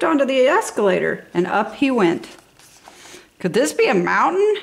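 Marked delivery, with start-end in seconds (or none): 3.4-4.6 wondering bear. Acted. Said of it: "he wondered".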